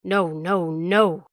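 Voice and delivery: loud, drawn-out dog yell; loud, wavering howl. A narrator's reading. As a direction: yell the refusal over the accusation. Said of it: "the dog yelled".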